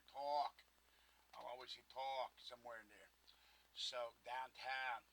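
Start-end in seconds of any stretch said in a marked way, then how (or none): 0.1-0.5 Pittsburgh accent. Shown as a demonstration. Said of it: "I can hear it in my voice".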